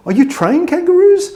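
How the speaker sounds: very high, loud, slow mock amazement; amazed American. Gullible American hearing kangaroos are trained, amazed mimicry. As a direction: drag out the astonished question.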